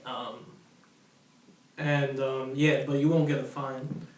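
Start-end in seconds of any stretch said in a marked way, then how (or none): none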